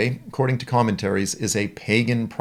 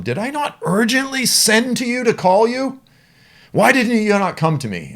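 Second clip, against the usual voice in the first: high, loud, indignant king; king Balak scolding a late Balaam; 12 semitones higher, 4 dB louder than usual. Acted. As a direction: demand why he ignored you.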